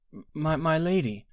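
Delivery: nervous stammer, pitched high. A narrator's reading. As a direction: stammer timidly to an angry queen. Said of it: "said the healer nervously".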